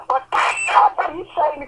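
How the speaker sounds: ordinary delivery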